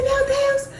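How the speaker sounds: high, tearful exclamation; tearful cry. Retold or imitated. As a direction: cry out in tears. Mother crying as daughter speaks. (exclamação chorosa)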